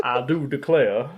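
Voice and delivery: slow, drawn-out Southern drawl; imitating a Southern accent. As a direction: stretch it like Ito's Southern act. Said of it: "when he does his Southern accent".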